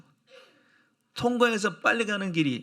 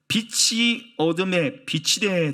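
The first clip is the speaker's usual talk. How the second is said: slow emphatic reading; 2 semitones higher, much slower than usual. Acted. Rereads the verse slowly.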